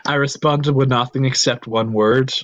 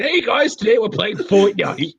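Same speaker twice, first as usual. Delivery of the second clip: high, hyped streamer intro; a hint of a gaming YouTuber greeting; Ninja streamer voice; 8 semitones higher than usual. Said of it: "ninja just climbs up her spine".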